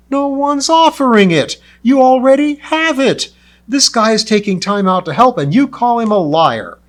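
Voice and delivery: shouting at a foolish friend; angry rebuke. Acted. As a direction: stress offering, exasperated.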